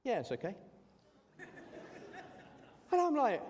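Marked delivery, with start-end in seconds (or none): 0.0-0.6 unimpressed shrug. Retold or imitated. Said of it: "He went". 1.4-2.2 high unvoiced disbelieving laugh; breathy laugh. Real, not acted. Preacher laughing at his coworker's flat reply, airy laugh.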